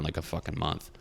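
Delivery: ordinary talk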